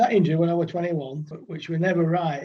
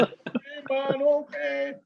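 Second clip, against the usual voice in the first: slow, laid-back much higher Jamaican voice; Jamaican drawl; 8 semitones higher, much slower than usual. Retold or imitated. Ken brushing off the batter in a slow Jamaican voice. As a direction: imitate the cool Jamaican bowler, drawn out slowly. Jamaican bowler's easy reply.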